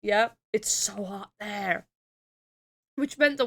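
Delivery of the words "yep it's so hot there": ordinary talk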